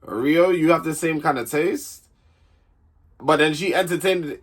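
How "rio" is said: usual speaking voice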